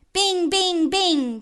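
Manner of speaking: high, ringing bell-like call; ringing gong imitation. Reciter imitates the fire gong, high ringing call. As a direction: sing out each stroke of the gong.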